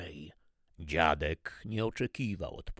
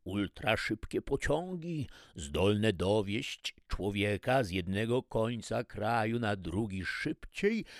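ordinary delivery

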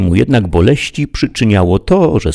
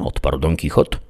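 ordinary delivery

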